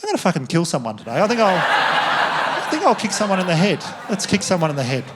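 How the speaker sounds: manic mock-killer inner voice; hammed-up gleeful menace; crazed horse's thoughts. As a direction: voice a horse plotting mayhem. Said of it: "in its head it's thinking".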